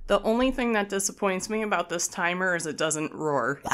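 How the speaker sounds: normal talking voice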